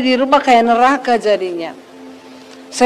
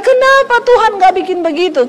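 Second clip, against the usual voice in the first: retold questioning; 8 semitones higher, faster than usual. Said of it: "saya bertanya".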